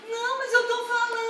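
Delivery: high, fast, soft tearful whine; whiny protest. Retold or imitated. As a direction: protest like a sulking child. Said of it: "Fala direito". (protesto choroso, agudo e rápido)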